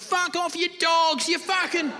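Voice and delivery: high-pitched defiant cursing; mock angry yell. God resisting the heaven police, high-pitched mock outrage. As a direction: curse them off in a high, straining voice.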